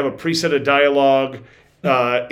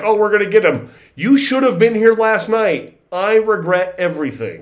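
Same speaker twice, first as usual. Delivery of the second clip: scripted NPC voice; 5 semitones higher than usual. Retold or imitated. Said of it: "computer program that just showed up to be like".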